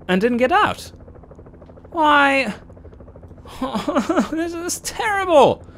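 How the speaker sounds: high, strained disbelief; exasperated high-pitched whine; exasperated outcry. Real, not acted. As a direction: cry out in frustration. Exasperated at lost time.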